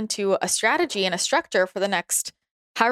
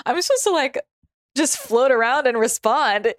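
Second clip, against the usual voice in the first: a hammed-up, amused "really?"; mock incredulous; 5 semitones higher, 4 dB louder than usual. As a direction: say it as if it were absurd. Mocking her own chart's advice.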